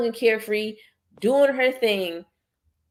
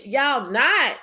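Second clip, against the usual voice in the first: ordinary talk, nothing marked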